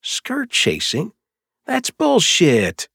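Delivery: high, slow indignant sputter. Acted. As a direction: sputter in outrage. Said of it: "Jax sputtered".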